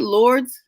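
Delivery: "Lords" said as one syllable; one-syllable name. Shown as a demonstration. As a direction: say "Lords" with no vowel before the final s. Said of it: "how do you pronounce her name".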